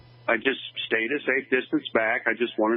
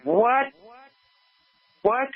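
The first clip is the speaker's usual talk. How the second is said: startled high what, what; baffled disbelief, pitch jumps up; shocked what; 12 semitones higher than usual. Retold or imitated. Retelling his shock at being accused, incredulous.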